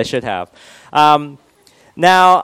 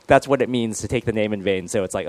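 ordinary delivery

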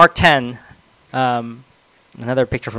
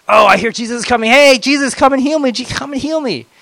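desperate blind man; 11 semitones higher, 7 dB louder than usual. Acted. Blind man voiced calling Jesus.

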